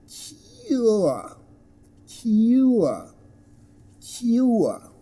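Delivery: high, falling "cure" as kyoo-uh; diphthong example. Shown as a demonstration. Teacher repeats an example word, "cure" high and gliding. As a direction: draw "cure" into two vowels, no r. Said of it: "as in".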